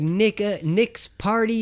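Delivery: high, drawn-out fake British voice; bad British accent. Guy answering the phone at Nick's party, bad British accent. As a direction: ham up a terrible British accent, slowly. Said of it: "super shitty British accent".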